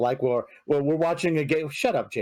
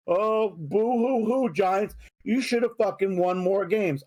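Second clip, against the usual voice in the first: slow, taunting boo-hoo; mocking Eagles fans; 4 semitones higher than usual. Eagles fans taunting Giants fans, slow mock boo-hoo. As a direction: sneer it like a gloating rival. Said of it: "Eagles fans in this area are like".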